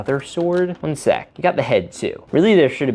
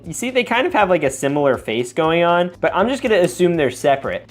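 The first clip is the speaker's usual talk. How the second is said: usual speaking voice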